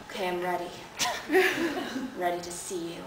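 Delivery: low-key and intimate; hushed, earnest lover. Acted. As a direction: say it quietly, as an intimate request. Bob's solemn request in a bedroom story.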